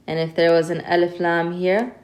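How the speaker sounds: ordinary talk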